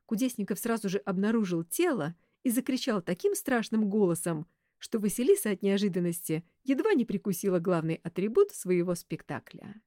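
ordinary delivery

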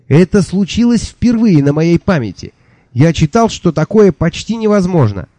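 high, breathless, amazed announcement; flustered excited archivist. Acted. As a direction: burst in with high amazed news. Flustered archivist tells news.

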